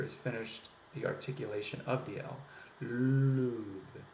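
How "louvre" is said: "Louvre" with a drawn-out L sliding into oo; French L. Shown as a demonstration. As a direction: round to oo before finishing the L in "Louvre". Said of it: "to the oo sound before the L has".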